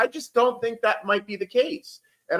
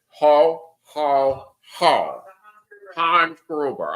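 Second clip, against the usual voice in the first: put-on low German-accented villain laugh, word by word; 5 semitones lower, 3 dB louder, much slower than usual. Imitating a Die Hard villain, German accent. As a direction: play a German movie bad guy. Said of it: "the, uh, the German accent".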